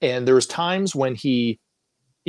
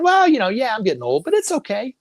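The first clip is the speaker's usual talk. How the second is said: imitating mom; 10 semitones higher, 4 dB louder than usual. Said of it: "And she kinda".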